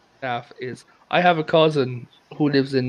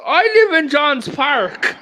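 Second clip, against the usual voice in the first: put-on stubborn old grandad; 10 semitones higher, slower than usual.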